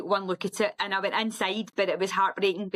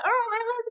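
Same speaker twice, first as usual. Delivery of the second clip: high sobbing wails, much higher; retold crying wail. To her husband on the phone, high sobbing wail. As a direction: wail like breaking down in tears. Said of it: "I was just screaming at him".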